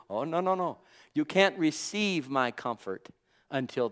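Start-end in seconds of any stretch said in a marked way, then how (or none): none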